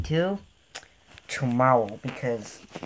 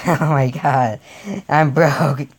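ordinary talk, nothing marked

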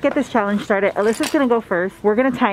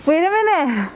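teasing; 8 semitones higher, slower than usual. Real, not acted. Teasing friend in store.

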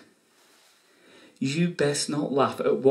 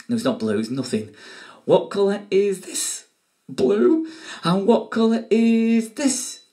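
excited quiz host; 7 semitones higher, 4 dB louder than usual. Acted.

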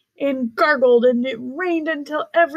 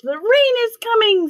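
high, eager announcement; excited; 11 semitones higher, 4 dB louder than usual. Acted. Zebras call out news.

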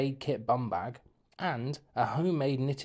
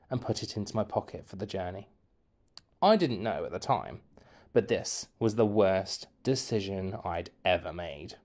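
ordinary delivery

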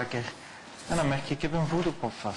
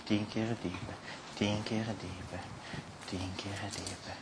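slow and fading ever softer; fading hypnotic deepening; 4 semitones lower, 9 dB quieter, slower than usual. Acted. Hypnotist deepening trance, slow fading voice. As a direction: let each repeat sink away into silence.

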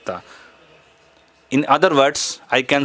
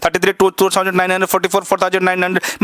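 very fast, slightly raised, rattled-off numbers; speed demonstration; 3 semitones higher, faster than usual. Shown as a demonstration. Showing ma'am how fast he can say numbers, high-speed recital. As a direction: race through the numbers in one breathless string. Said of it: "मैं देखना ma'am कितने speed में बोल दूंगा".

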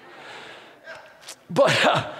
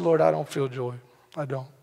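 subdued prayer; 4 semitones lower, 3 dB quieter than usual. Retold or imitated. Retold joyless prayer.

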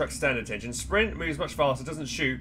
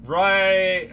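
loud; 6 semitones higher, 11 dB louder than usual. Real, not acted. Loud shout before reading tutorial.

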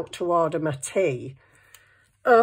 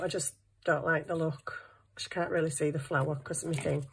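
normal talking voice

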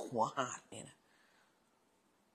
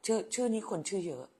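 ordinary talk

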